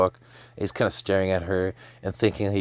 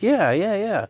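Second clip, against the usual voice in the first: quick yeses pitched way up; eager agreement; 12 semitones higher, slower than usual. Retold or imitated. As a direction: play a high, eager reply. Acting an eager reply in retelling.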